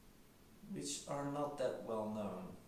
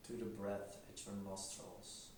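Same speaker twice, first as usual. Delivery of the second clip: quiet, unhurried meditation voice; guided meditation; 10 dB quieter than usual. Acted. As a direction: gently guide them into stillness, leaving long silences.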